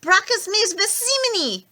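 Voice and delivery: a yelled Latin curse. A narrator's reading. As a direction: hurl the words like a curse. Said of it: "I yelled".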